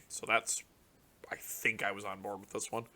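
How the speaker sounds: soft unvoiced aside to himself; whispered aside. Real, not acted. Musing to himself over a card, whispered. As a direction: murmur it under your breath.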